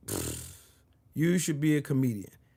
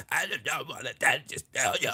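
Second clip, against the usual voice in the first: softer, strained-sounding mock politician mumble; mock Robert F. Kennedy; 5 semitones lower, 6 dB quieter than usual. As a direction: mimic a fading, halting voice mockingly. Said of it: "What happened to your voice, bitch? ... Why can't you talk normal?".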